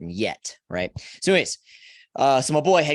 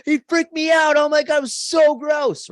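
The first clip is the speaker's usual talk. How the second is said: much higher, louder disgusted buddy voice; grossed-out friend; 6 dB louder than usual. Retold or imitated. Friend describing worms he passed, high and disgusted. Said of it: "And he's like".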